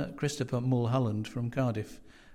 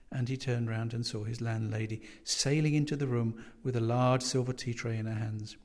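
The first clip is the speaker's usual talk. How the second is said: usual speaking voice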